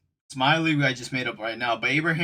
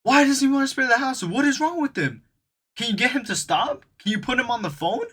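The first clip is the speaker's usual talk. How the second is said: much higher, outraged manager voice; hammed-up frantic manager, much higher; upset manager; 11 semitones higher than usual. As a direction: squeal like a panicked boss. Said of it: "Abraham was like".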